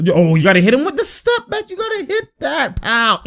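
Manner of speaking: high squealed urging; excited pleading. Real, not acted. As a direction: let the pitch climb to a squeal as you plead. Agonizing over a player's move.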